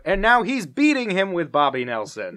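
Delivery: high, loud mock commentator; excited announcer quote. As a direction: call it out like a contest announcer.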